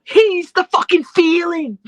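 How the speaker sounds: slow, loud British drawl; Ospreay impression. Co-host finishing the Ospreay bit, slow British accent. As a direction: stretch it out like Ospreay. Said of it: "an Ospreay impression".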